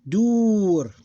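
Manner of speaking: dur drawn out high and loud, ending on r; one closed syllable. Shown as a demonstration. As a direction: stretch the u in dur and stop on r. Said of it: "this is closed syllable".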